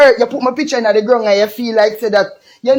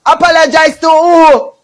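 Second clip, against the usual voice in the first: loud scoffing question; 6 semitones higher, 6 dB louder than usual. Real, not acted. Mocking demand for an apology.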